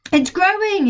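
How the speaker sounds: put-on high, thrilled boy's cry; excited yell. George yelling to Grandma that the hen is growing. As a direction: shout it out in delight. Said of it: "he yelled".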